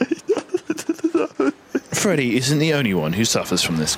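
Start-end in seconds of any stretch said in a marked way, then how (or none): 0.1-1.5 high voice cracking into laughter; laughing through falsetto. Acted. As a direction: lose it mid-line laughing. Breaks into laughter mid-line.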